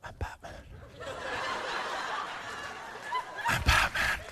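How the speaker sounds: gravelly whispered Batman growl. Retold or imitated. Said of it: "look in the mirror and say".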